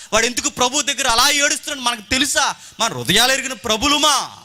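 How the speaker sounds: impassioned preacher, pitch held high; full-force preaching. Real, not acted. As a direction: press each question on the congregation.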